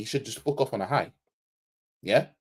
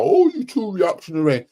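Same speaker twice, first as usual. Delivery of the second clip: higher, louder mock complaint; mocking imitation; 7 semitones higher, 6 dB louder than usual. Imitating fans who dismiss his criticism, mocking. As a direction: throw it back in a put-on higher voice.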